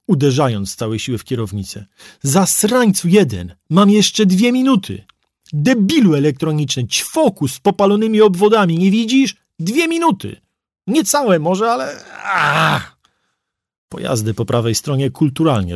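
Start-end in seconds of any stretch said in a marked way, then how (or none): 2.2-13.0 furious rant. Acted. Driver raging at police drone.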